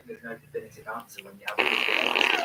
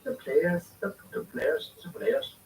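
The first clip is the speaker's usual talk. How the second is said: put-on a rough, half-hearted try at an Irish Roy Keane accent, pitched higher; tentative Irish Roy Keane impression, higher up; 7 semitones higher, slower than usual. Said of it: "I'd love to be able to do a Roy Keane accent".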